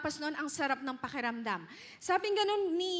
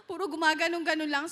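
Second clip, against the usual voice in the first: sobbing gasp; 4 dB louder, faster than usual. Shown as a demonstration. Preacher shows Hannah sobbing without words, gasping. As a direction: mimic the wordless hiccup of crying. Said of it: "sinok lang ng sinok".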